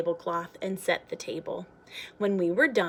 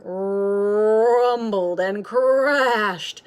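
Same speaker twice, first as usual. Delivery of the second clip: slow, louder rumble; rumbling thunder; 7 dB louder, much slower than usual. A narrator's reading. Narrator evoking the storm, slow louder rumble. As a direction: roll the words like thunder.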